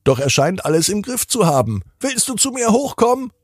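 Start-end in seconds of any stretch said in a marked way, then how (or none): 2.0-3.3 sehr hohe, kindliche Stimme; Kinderstimme Basti. Acted. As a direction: wie ein kleiner Junge, hoch und fröhlich. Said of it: "ruft Basti". (der kleine Bruder ruft von oben zu Tina, sehr hoch)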